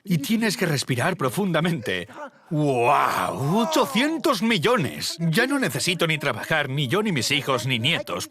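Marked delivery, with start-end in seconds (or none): 2.5-3.6 amazed shout. Retold or imitated. Man seeing his bank balance, amazed shout. Gasping at the money. 3.6-5.2 much higher, slow, awestruck; stunned amazement. Retold or imitated. Man staring at his new fortune, awestruck. Gasping at the money.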